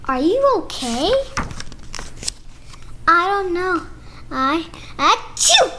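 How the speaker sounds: lively picture-book read-aloud with character voices; reading to children. Acted. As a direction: read it like story time for little kids.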